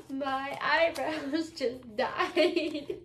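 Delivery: drawn-out "eyebrows just died"; slowed for guesser. Real, not acted. Repeating the phrase for a guessing partner, very slowly. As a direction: stretch the words out slowly.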